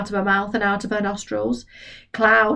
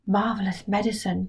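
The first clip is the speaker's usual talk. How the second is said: much softer, murmured to himself; 6 dB quieter than usual. Acted. George marvels to himself, much softer. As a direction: murmur it in wonder.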